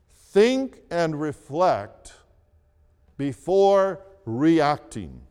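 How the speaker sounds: "Think and reflect before reacting" said slowly, word by word; slow deliberate. Shown as a demonstration. Pastor stating step three slowly for the congregation. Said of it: "Here is step three".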